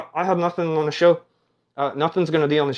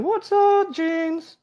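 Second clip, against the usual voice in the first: high excited greeting; 14 semitones higher, much slower than usual. Pretend.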